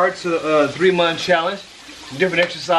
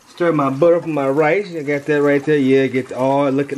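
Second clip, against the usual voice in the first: ordinary delivery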